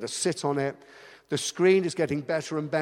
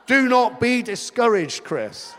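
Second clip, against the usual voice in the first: loud, high playful telling-off; mock scolding shout; 7 semitones higher, 5 dB louder than usual. Pastor voicing a congregant scolding him, loud. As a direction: bark it like a friendly slap. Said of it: "come and slap me around the face".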